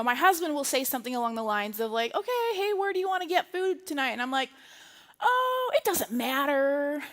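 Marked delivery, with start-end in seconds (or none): 2.1-4.1 lightly acted, higher-pitched casual question; imitated husband asking. Voicing husband's casual dinner question. 5.2-7.0 breezy non-answer. Retold or imitated. Acting out her breezy non-answer.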